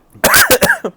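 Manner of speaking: loud high cough from inhaled spice; choking cough. Real, not acted. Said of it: "I breathed it".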